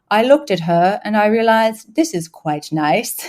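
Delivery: imitating a reserved English duke; mock posh English understatement; understated upper-crust British. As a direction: put on a restrained fancy British accent. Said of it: "they're very understated in their romance".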